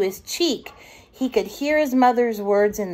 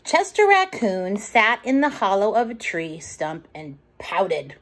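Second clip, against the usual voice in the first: usual speaking voice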